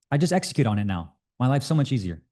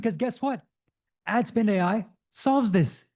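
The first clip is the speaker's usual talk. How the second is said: much higher, hyped-up pitch; excited; 8 semitones higher than usual. Real, not acted. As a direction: sell it with high-pitched enthusiasm.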